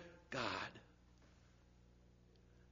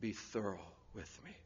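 much softer, slow plea; hushed prayer; 6 semitones lower, 13 dB quieter than usual. Real, not acted. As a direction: pray it quietly.

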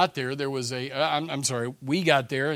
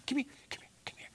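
urgent unvoiced whisper; whispered call; 12 semitones higher, 9 dB quieter, much faster than usual. Hidden homeowner whispering to their dog, urgent. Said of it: "somebody's going".

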